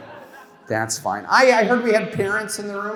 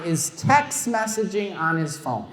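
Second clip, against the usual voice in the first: normal talking voice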